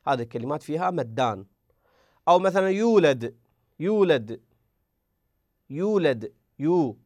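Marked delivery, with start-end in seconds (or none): 2.3-6.3 يولد with the long u held two beats; natural vowel length. Shown as a demonstration. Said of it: "واو ساكنة مضموم ما قبلها". (يولد بمدّ الواو حركتين)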